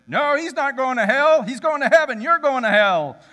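put-on high, loud drunken shout; drunk yelling back. Drunk guy shouting back at the preacher, loud. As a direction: roar back in his friend's defence.

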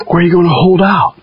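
ordinary talk, nothing marked

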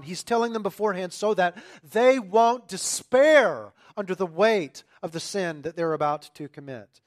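loud, impassioned preaching; preaching at full force. Acted. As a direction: drive it home to the congregation.

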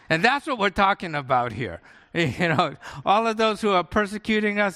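ordinary talk, nothing marked

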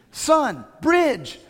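loud high shout from the mat side; yelling coach. Retold or imitated. Dad yelling at his wrestling son from the side. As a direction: bark the move across the mat. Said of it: "I'm yelling".

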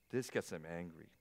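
ordinary talk

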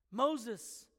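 ordinary talk

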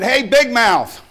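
drawn-out challenge to the bully; calling out. Retold or imitated. Sailor calling out the barracks bully, loud and slow.

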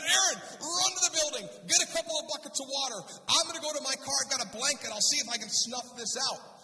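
high, loud panicked commands; urgent shouted orders. Retold or imitated. Pastor sends Aaron for water as fire spreads, urgent shouting. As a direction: call out instructions in a re-enacted emergency.

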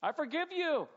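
high, loud and tender, the last words slow; a parent's joyful, tender I forgive you; warm forgiveness. Shown as a demonstration. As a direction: beam out forgiveness to a sorry child. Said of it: "I can immediately at this point be like".

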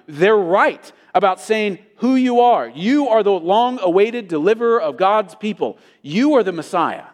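emphatic preacher's declaration, "You" hit firmly; emphatic confession. Acted. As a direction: proclaim it with conviction, pressing on "You". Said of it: "it has never been this emphatic".

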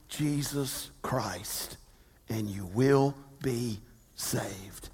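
quiet reverent reading; soft scripture reading. Real, not acted. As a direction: let the verse fall gently. Softly quoting scripture.

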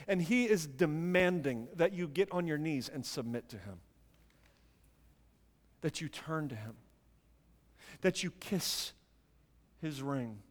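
low, soft, with long pauses; quiet solemn demand. Real, not acted. Preacher urges listeners to submit to him, low and hushed. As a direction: press the point softly, phrase by phrase.

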